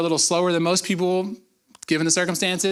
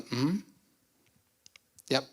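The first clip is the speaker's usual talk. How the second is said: calm God; 3 semitones lower, 10 dB quieter than usual. Retold or imitated. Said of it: "And God's going".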